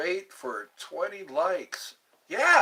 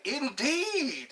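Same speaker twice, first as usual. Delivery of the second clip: high, lightly British "Indeed"; a hint of a posh UK indeed; mild British imitation; British accent; 9 semitones higher than usual. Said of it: "That's how they talk".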